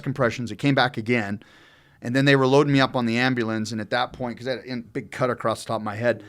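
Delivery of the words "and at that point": ordinary delivery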